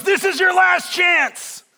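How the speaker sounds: angry, high, drawn-out shout; Indy. Acted. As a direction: bellow a furious final warning. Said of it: "Harrison Ford is so angry".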